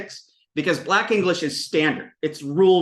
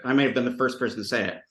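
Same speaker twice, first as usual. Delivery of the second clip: normal talking voice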